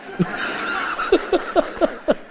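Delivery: airy unvoiced laugh after the punchline; breathy laugh. Real, not acted. Pastor laughing at the big pig punchline, airy laugh. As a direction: laugh at your own joke.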